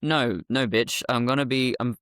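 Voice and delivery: normal talking voice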